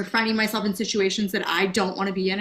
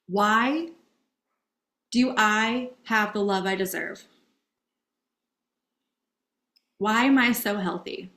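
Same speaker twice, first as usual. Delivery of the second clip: guided affirmations. Acted. Guides affirmations, eyes closed.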